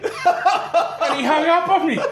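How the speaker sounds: high, slow words through laughter; giggling out the end of the joke; laughing. Real, not acted. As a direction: crack up at your own punchline. Punchline delivered laughing.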